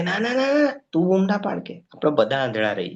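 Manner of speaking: high, hurried "no, no" to hush him; a high-pitched mock beggar begging him to stop; jealous beggars hushing. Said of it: "બીજા ભિખારીઓને તો એવું થાય". (ઊંચા અવાજે "ના, ના" કહી ચૂપ કરાવતો ઈર્ષાળુ ભિખારી)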